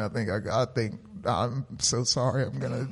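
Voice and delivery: quiet, feeble, in pain; weak sick voice. Retold or imitated. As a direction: barely get the words out, sick. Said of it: "that's how I was talking".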